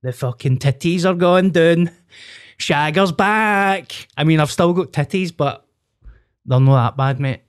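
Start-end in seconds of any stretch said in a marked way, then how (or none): 0.0-4.1 cocky boast. Retold or imitated. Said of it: "I was like".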